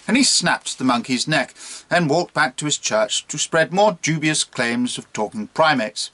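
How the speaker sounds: usual speaking voice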